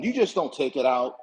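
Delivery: normal talking voice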